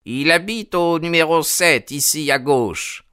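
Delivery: put-on high, helpful old man voice; old man directing. A narrator's reading. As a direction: point the way kindly.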